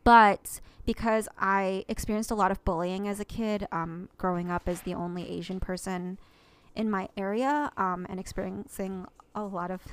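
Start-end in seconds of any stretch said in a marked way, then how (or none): none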